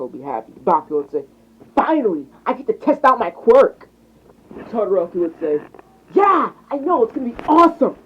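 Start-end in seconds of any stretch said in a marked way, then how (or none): 1.7-3.9 raised pitch, eager and loud; higher, louder thrilled kid; excited schoolboy. A narrator's reading. Excited pupil voiced in story. 6.1-8.0 put-on high, loud thrilled kid; eager, pitched-up classmate; excited agreement. A narrator's reading. Friend agrees excitedly in story.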